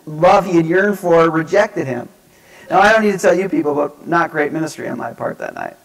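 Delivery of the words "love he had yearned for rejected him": loud, high-pitched mounting rant; indignant realization. Retold or imitated. Youth worker piecing together teen's heartbreak, indignant rant. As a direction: pile up the evidence in exasperation.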